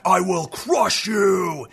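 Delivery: slow booming brute shout; giant brute. Retold or imitated. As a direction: bellow it like a club-wielding giant. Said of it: "He's got a gigantic club and he's like".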